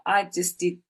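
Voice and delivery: ordinary delivery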